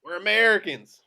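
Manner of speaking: loud holler to someone far off; big, loud call; yelling down the hall. Acted. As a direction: holler it down the hallway. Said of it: "Aldo's voice yells down the hall".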